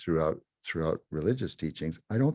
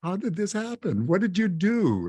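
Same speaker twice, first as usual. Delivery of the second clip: high, aghast imitation of stunned hosts; mock dismay; 13 semitones higher than usual. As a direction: pitch it up like a horrified onlooker. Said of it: "they're looking at me thinking".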